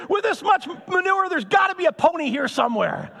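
put-on high-pitched, excited child's exclamation; jubilant optimist son. Optimist son answers dad from pile of manure, jubilant. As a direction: burst out with it, hammed-up glee. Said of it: "optimist son jumping up and down".

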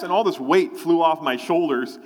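ordinary talk, nothing marked

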